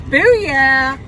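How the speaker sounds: loud, drawn-out triumphant cheer; hyped-up whoop. Pretend. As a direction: whoop it out for the crowd. Hyped street performer showing off.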